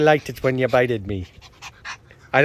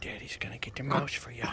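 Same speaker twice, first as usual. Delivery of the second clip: whisper; 12 dB quieter than usual. Real, not acted. Teasing dog with toy, whispering.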